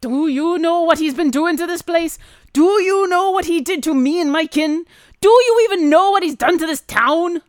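loud, high, mounting dwarf rant; outraged gruff dwarf. Acted. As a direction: build each question into an indignant dwarf shout. Plays angry dwarf.

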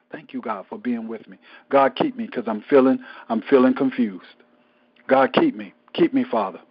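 low, hushed plea to God growing to pleading; murmured prayer. Retold or imitated. Preacher voices a private prayer.

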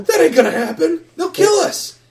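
loud, high frantic warning; panicked kid. Acted. Kid warning of pirates, panicked. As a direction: warn them in a squeaky frantic voice.

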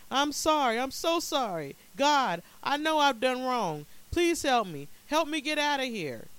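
high, loud begging for forgiveness; desperate cry. A narrator's reading. Man confessing his wrongs to God, pleading for help, desperate cry. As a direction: plead urgently, voice pitched right up. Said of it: "he cried".

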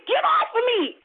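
high, urgent protest; alarmed. Retold or imitated. Woman telling the thing clinging to her to let go, alarmed. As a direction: push the attacker off with a high cry. Fighting off attacker in retold dream.

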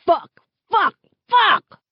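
high, loud repeated curses; anguished outburst. A narrator's reading. Brother rocking in the driver's seat, anguished shouts. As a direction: explode in grief and frustration.

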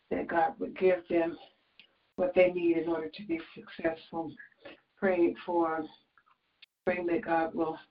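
earnest, steady prayer voice. Acted. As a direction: pray aloud for the listeners. Leads a prayer call.

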